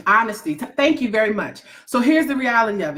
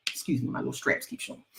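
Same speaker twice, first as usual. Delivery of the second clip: whispered aside; 8 semitones lower, 11 dB quieter, faster than usual. Real, not acted. Quick aside about her clothes.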